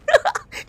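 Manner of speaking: squealing burst of laughter; high shrieking laugh. Real, not acted. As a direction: let out a shrill giggle. Bursts out laughing mid-story. (tiling na tawa)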